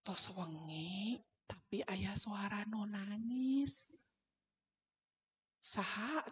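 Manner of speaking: very soft, slow, hushed voice; slow and hushed parental murmur; softened voice. Retold or imitated. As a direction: whisper slowly, as if to a child at night. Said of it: "Jadi suara saya tuh dipelankan".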